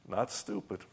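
normal talking voice

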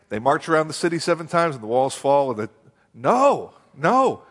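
ordinary talk

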